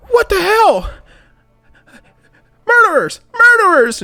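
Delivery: high, loud panicked scream; woman screaming murderers at the group by the dead body. Acted. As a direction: shriek it at the crowd in horror. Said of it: "a woman screams".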